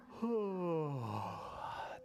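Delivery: long drawn-out yawn; big yawn. Acted.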